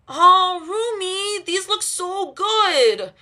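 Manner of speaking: high, slow sleepy whine; drawn-out whiny delight; whining. A narrator's reading. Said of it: "Zoe whines".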